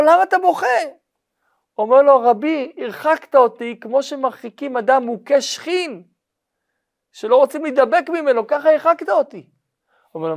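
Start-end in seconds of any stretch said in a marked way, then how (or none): 0.0-0.7 much higher, louder alarmed question; teacher alarmed at crying student; startled concern. Acted. Rabbi asks why he cries. 2.2-9.5 hurt plea. Acted. Student pleads with his rabbi.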